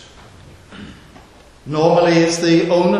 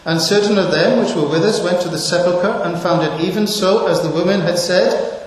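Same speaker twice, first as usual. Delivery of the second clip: raised, solemn reading of the verse; scripture reading; 4 semitones higher, 5 dB louder than usual. Acted. Preacher reading the disciples' words to the church, raised and solemn. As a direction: read it out to the congregation with weight.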